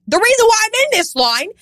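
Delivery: high mock-exasperated complaint; exasperated inner rant. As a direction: let the exasperation ring out. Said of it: "I was like".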